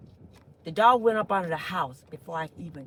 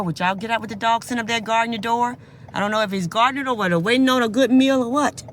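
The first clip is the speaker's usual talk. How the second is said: worked up; 8 dB louder than usual. Real, not acted. Pleading with dog loudly.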